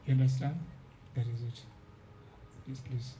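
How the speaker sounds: normal talking voice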